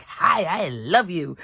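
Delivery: slow, lightly voiced cheerful pup; happy dog voice. Crafter voicing a laughing dog on her stamp, lightly voiced. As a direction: give the dog a sweet little greeting. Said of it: "laughing like".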